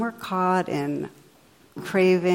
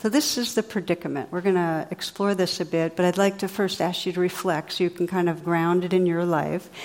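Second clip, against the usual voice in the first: ordinary talk, nothing marked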